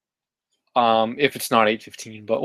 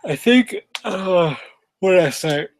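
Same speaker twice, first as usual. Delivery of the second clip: talking through a yawn; words stretched by a yawn; 6 semitones higher than usual. Real, not acted. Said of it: "Ah, I'm yawning".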